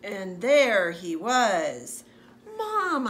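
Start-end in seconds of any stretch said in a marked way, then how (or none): 2.5-3.1 put-on high, loud child's shout; excited kid yelling mom. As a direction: call out to mom across the bakery. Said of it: "I yelled".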